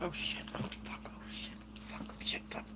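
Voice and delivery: whispered cursing. Real, not acted. Muttered curses after mishap.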